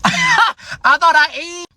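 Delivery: high, slow words through laughter; laughing at himself. Real, not acted. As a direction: crack up mid-sentence, pitch way up. Laughs at his own angry paragraph.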